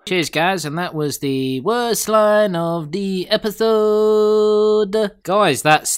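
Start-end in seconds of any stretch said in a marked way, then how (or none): 1.6-5.1 slow, drawn-out announcer read. Acted. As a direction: announce it like a show host. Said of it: "host's sign-off announcement".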